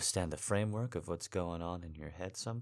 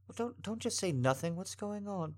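high-pitched, louder alarm; alarmed, raised, pleading voice; worried pleading; 11 semitones higher, 5 dB louder than usual. Acted. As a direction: press them anxiously for an answer. Alarmed at partner crying.

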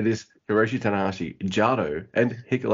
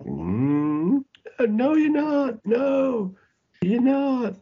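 very high, drawn-out squeaky denial; mocking disbelief; 4 dB louder, much slower than usual. Fan doubting the wrestlers' boast, high mocking denial.

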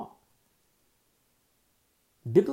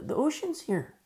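squeaky-high, helpless objection; high-pitched protest; 13 semitones higher, 3 dB louder than usual. Acted. Moses tells the Lord he can't go on, high-pitched protest. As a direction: voice shoots way up in protest.

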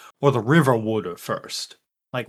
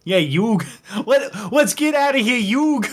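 put-on Philly accent; 8 semitones higher than usual. Character accent dissolving in laughter.

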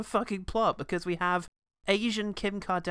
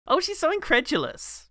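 sarcastic; 10 semitones higher, 5 dB louder than usual. Acted. Sarcastic review of film heroine.

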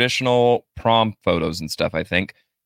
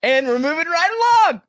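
high singsong. Acted. Pushing past the joke.

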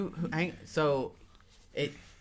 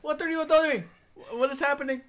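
very high, raised-voice cry; bewildered yell; 11 semitones higher than usual. Acted. Jose yelling at the party, high bewildered yell. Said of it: "Jose yells".

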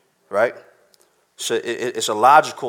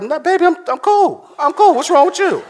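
mock drugged voice, much higher; hammed-up stoned boyfriend pitched way up; high-as-a-kite denial; 4 dB louder than usual. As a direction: squeak out a fake-casual I'm cool. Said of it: "I'm like".

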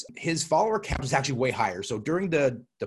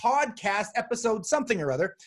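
hyped show opening; 10 semitones higher than usual. Real, not acted. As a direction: kick off the show with a big, high hello. Podcast host's show-opening greeting.

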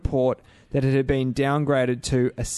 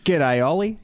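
mock Aussie greeting; slower than usual. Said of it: "the fact that he went".

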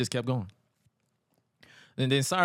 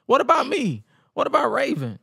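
high-pitched imitated whine of protest; mock indignant complaint; 11 semitones higher, 4 dB louder than usual. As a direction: protest like you've been overlooked. Acting out Raven's self-pitying complaint.

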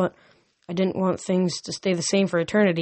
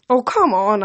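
complaining; 3 semitones higher, 7 dB louder than usual. A narrator's reading. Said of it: "I complained".

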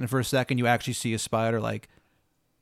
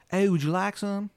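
high-pitched bad Maryland accent; Baba Yaga; 8 semitones higher than usual. Baba Yaga dodging a question, hammed-up Maryland accent. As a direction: exaggerate the Maryland twang, pitched up. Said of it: "She's got the shittiest Maryland accent".

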